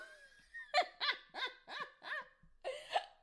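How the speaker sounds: long, high, loud cackle; helpless cackling fit; real cackle. Real, not acted. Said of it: "I cackled".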